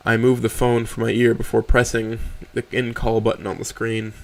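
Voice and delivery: usual speaking voice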